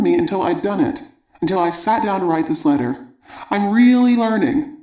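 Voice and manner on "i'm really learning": higher-pitched, pleased discovery; proud, happy exclamation; delighted realization. A narrator's reading. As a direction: celebrate your progress, voice lifted. Letter writer celebrates her progress.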